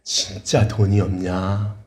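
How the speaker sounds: low, very slow, softened question; the Lord. Retold or imitated. The Lord questions the desperate debtor, low and slow. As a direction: ask slowly and low, stretching the words. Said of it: "근데 주님이".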